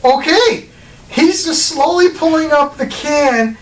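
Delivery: high, slow, amazed commentary; excited. Real, not acted. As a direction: marvel at it as it happens. Excited watching beer pour trick.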